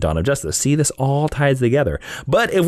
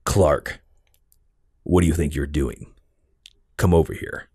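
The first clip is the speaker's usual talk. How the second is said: low, gravelly-sounding Batman growl, lower; 9 semitones lower, 3 dB quieter than usual. Shown as a demonstration. Host auditioning his own Batman voice for the co-host. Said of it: "the voice that I would use as my Batman".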